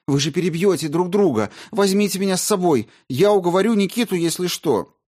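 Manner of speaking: high, loud, frightened pleading. A narrator's reading. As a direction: beg in panic. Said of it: "Маргарита испуганно затараторила".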